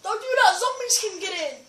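high, loud warning shout; alarmed warning. Real, not acted. Player warning friend zombies can get in, alarmed shout. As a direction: yell to stop them at once.

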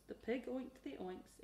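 usual speaking voice

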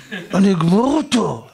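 slow, drawn-out menacing vow; imitated hothead threat. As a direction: strain it out as a furious tough guy. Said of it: "אחד עושה לי פרצוף כזה". (איום מרוח של בריון מתלהט)

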